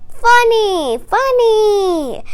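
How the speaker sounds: high, loud happy cheer; crowd shouting. A narrator's reading. Crowd shouts delight at Frog's joke, high loud cheer. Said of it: "The crowd shouted".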